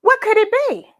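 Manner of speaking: high, eager mystery question; excited storyteller question; suspense. A narrator's reading. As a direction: draw the kids into the mystery. Building suspense for kids.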